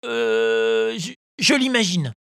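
put-on high, hesitant puppet boy; stammering child. A narrator's reading. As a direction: stammer out a lame excuse. Pinocchio stammers excuses. (voix d'enfant qui bafouille)

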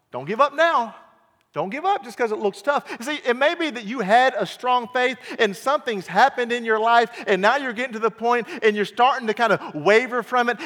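loud, high, rising sermon climax; preaching at full force. Acted. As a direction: drive the plea home to the church.

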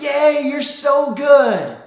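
high, loud, slow delighted cheer; cheering parent. Retold or imitated. Parent cheers toddler daughter after her fall, high and loud. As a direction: cheer her on as she falls again. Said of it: "We're like".

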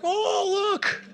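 very high-pitched mock delight; hammed-up gullible enthusiasm, loud; naively excited. Preacher voices people lured by the enemy's bait, naively excited. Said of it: "everybody's like".

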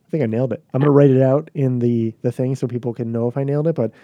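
ordinary talk

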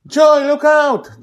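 put-on a boy shouting to Joy about the tentacle. A narrator's reading. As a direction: yell a warning across the street. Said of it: "Charlie shouts".

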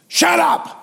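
sudden high-pitched snap; startling outburst. Retold or imitated. Preacher cutting off the sinner boy without thinking, startling outburst. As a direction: blurt it out sharply before thinking. Blurted before thinking, scaring both men.

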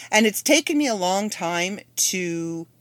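usual speaking voice

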